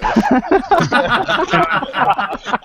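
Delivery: big, high burst of laughing; loud laughter. Real, not acted. Players react to the speech to the dagger, loud high laughter. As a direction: crack up out loud.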